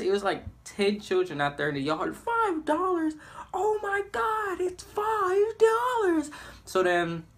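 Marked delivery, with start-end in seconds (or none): 2.1-6.3 much higher, slow, amazed squeal; hammed-up childish thrill; excited kids. Kids in the yard hearing about the money, squealing slowly.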